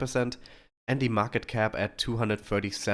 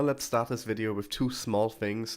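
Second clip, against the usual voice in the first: normal talking voice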